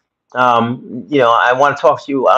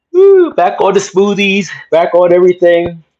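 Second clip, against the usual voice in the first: excited cheer; 8 semitones higher, 6 dB louder than usual. Real, not acted. To his live viewers, celebrating being back on smoothies, excited cheer. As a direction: whoop it up like a comeback.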